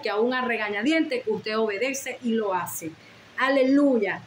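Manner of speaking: ordinary talk, nothing marked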